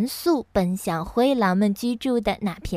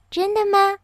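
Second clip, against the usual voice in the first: high, delighted question; 10 semitones higher, 4 dB louder than usual. A narrator's reading. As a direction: ask in happy surprise, voice lifted. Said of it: "仙女高兴地问". (惊喜地高声问)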